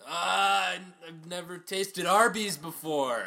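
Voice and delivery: loud high slow dying lament; death cry. Shown as a demonstration. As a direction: wail a final regret. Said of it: "What would be your death cry".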